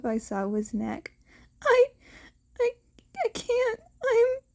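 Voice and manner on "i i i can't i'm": high, broken sobbing stammer. Acted. Teen breaking down in his teacher's arms, sobbing. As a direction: choke the words out through sobs. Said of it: "A tortured sob ripped itself".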